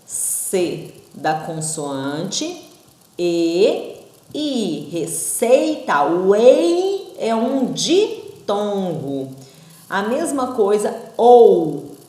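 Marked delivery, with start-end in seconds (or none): 0.0-9.3 slowly spelling out the sounds of Receita; teacher breaking a word into sounds; slow syllable demo. Shown as a demonstration. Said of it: "Ó". 11.2-11.9 ou called out loud and clear; teacher calling out the vowel pair; loud vowel demo. Shown as a demonstration. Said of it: "O o e o u são duas vogais".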